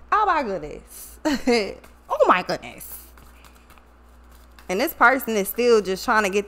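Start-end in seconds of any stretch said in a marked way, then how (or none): none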